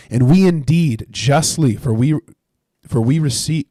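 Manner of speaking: loud, earnest reading of the thieves' words; scripture reading. Acted. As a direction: proclaim the passage to the room. Reads scripture aloud.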